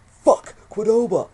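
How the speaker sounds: drawn-out, high, loud outburst; excited shout. Retold or imitated. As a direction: yell it like a sudden revelation. Sudden craving thought, acted out.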